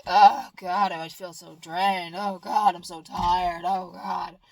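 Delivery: loud "Oh, God" sinking into slow, worn-out moaning; a mock tired moan of someone worn out; mock drained groaning. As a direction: ham up being exhausted and drained. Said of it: "instead of be like".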